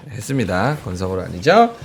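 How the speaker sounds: usual speaking voice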